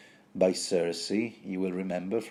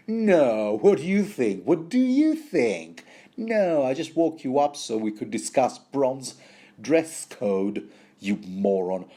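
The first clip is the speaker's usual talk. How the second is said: raised, exasperated mock disbelief, then dropping; heavy sarcasm; 3 semitones higher, 4 dB louder than usual. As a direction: lay the sarcasm on thick for a dim servant. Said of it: "his sarcasm is clearly wasted".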